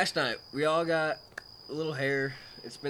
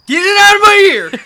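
high, full-force panicked yell; imitated scream; 21 dB louder than usual. Friend imitating Jake with a bug in his ear, screaming. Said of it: "Screams it twice".

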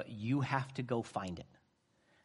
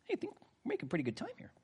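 softer, fast aside; quick aside; 10 semitones higher, 8 dB quieter, much faster than usual. Real, not acted. Preacher noting the time, quick soft aside. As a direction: toss it off under the breath.

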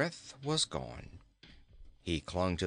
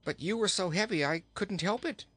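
higher, mildly upset apology; real distress; 8 semitones higher than usual. A narrator's reading. Boy excuses himself for dropping the king, mildly distressed. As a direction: plead it higher, a touch distressed. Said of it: "called Inga in real distress".

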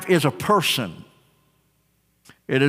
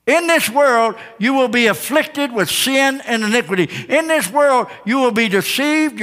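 higher, rising sermon cadence; full-force preaching; 6 semitones higher than usual. Acted. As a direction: build the preaching with each phrase.

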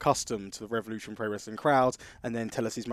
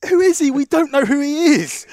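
much higher, louder heckler shout; mock crowd heckle; 15 dB louder than usual. Fan in the crowd heckles the ring, shouting. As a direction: bellow it from the back of the crowd. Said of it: "someone from the crowd shouts out".

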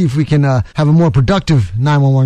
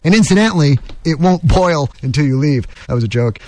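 ordinary talk

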